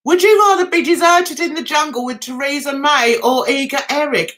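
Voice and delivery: normal talking voice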